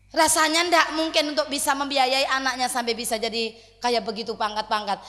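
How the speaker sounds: usual speaking voice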